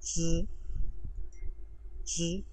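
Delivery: च said as a crisp Chinese ch sound, drawn out alone; teacher sound demo. Shown as a demonstration. Teacher modelling a Chinese sound for students, careful and clear. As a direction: model च slowly for the class to copy. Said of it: "अगला sound है हमारे पास".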